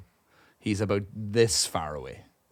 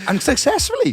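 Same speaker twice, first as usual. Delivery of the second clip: high-pitched amazed exclamation; real disbelief; surprised; 6 dB louder than usual. Real, not acted. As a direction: sound genuinely astonished. Amazed at friend's story.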